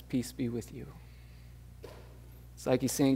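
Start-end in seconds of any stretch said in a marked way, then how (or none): 0.0-0.9 soft, much quieter "Peace be with you"; gentle greeting. Retold or imitated. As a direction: speak it tenderly as the risen master. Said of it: "that familiar voice calls out and says".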